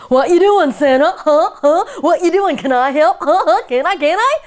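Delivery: high, loud, eager little polar bear. A narrator's reading. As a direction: bounce like a pestering little cub.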